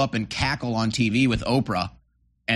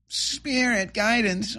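sneering, pitched-up echo of the slogan; mocking quote; 9 semitones higher, much slower than usual. Mocking the candidate's slogan words back, high and scornful. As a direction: toss the words off higher with scorn.

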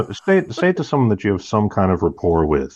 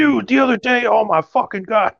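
high, worked-up impression; mad absent co-host; 13 semitones higher than usual. Host imitating the absent co-host being mad, worked-up. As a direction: launch a mock rant in a put-on high voice. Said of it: "He'd be mad".

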